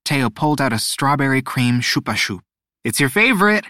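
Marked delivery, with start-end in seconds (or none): 2.8-3.6 put-on high, eager boy's voice; bright and pleased, offering a gift; excited.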